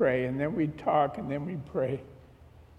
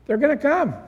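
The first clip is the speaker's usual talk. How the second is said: imitated astonishment, much higher and louder; amazed relay; 10 semitones higher, 5 dB louder than usual. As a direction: relay surprising news in a high voice. Retold amazed news to wife.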